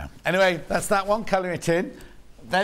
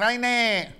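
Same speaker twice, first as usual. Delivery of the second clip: a put-on cockney London voice, higher and louder; mock London accent that drifts toward Brummie; 6 semitones higher, 4 dB louder, slower than usual. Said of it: "That was meant to be a London accent".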